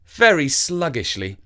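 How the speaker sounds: usual speaking voice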